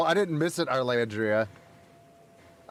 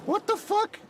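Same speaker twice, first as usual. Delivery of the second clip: shocked. Real, not acted. Shocked at cannibal kids.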